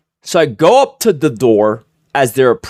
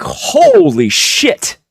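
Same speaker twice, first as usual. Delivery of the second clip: high, slow, drawn-out awe; awed holy shit; 11 semitones higher, much slower than usual. Retold or imitated. Swat cops stunned at the scene, high drawn-out awe. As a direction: stretch it out in stunned wonder.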